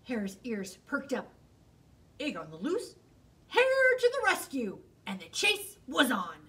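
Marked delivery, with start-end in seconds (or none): 2.2-3.0 low, curious rising question; perked-up question. Acted. Hare hears the cry. 3.5-4.8 high, loud and fast battle cry; heroic excitement. Acted. Hare rushing off to save the egg, excited and fast.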